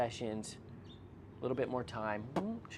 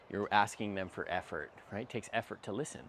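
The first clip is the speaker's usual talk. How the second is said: ordinary talk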